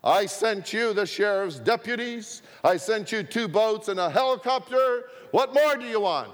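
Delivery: exasperated divine voice from the sky; booming voice of God. Acted. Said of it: "a huge voice boomed out".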